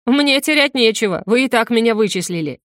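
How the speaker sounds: plain reading voice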